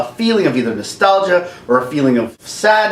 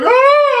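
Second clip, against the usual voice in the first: much higher, louder startled scream; scream of fright; 6 dB louder than usual. Retold or imitated. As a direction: shriek at the T-Rex. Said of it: "I was like".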